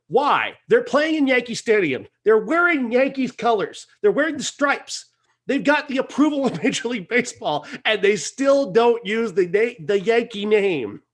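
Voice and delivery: high, exasperated listing of grievances; incredulous rant. Real, not acted. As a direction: pile up the evidence in disbelief. Incredulous rant about missing team name.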